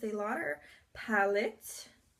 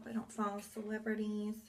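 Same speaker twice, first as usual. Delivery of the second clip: usual speaking voice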